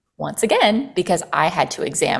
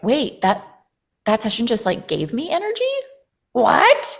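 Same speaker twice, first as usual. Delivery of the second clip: incredulous surprise; 5 semitones higher than usual. Retold or imitated. Realizing a session gave her energy, incredulous. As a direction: pitch climbing to a high, disbelieving "What?". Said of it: "I'd be like".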